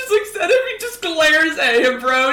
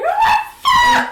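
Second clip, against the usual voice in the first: high, wailing scream of horror; much faster than usual. Acted. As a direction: scream it in acted fright. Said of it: "She's screaming".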